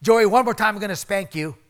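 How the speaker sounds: imitating a mother threatening her misbehaving son; mock parental warning. Mother's warning in the retold story.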